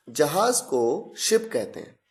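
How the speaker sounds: usual speaking voice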